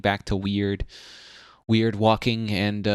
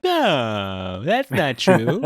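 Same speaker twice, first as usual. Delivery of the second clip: mock denial; 7 semitones higher, 5 dB louder, much slower than usual. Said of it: "She's like".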